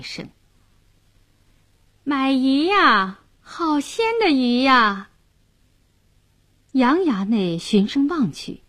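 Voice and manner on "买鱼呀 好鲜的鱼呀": sweet, crisp vendor's cry. A narrator's reading. Fishwife hawking fresh fish from her boat, sweet and crisp. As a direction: sing out your wares sweetly across the water. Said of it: "一溜甜脆脆的叫卖声".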